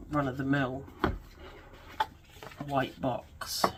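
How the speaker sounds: ordinary talk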